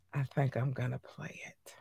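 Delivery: hushed, whispered confession; conspiratorial whisper. Pretend. Host deciding to be a bad girl, whispering. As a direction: whisper it like a guilty secret. Naughty aside before playing it.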